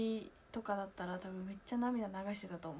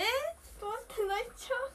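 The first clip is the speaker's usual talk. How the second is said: much higher, tearful quaver; about to cry; 12 semitones higher than usual. Real, not acted. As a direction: voice breaking over a letter. (泣きそうな声で)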